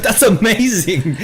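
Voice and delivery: high, slow, laughing amazement; thrilled, drawn-out reaction, laughing; delighted. Retold or imitated. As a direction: gush it through laughter. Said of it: "I was like".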